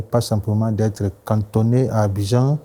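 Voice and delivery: ordinary talk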